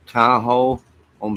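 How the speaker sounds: loud call of the name; calling out to his dog Tahoe. Real, not acted. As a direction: call Tahoe over sharply.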